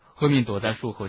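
usual speaking voice

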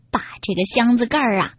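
ordinary delivery

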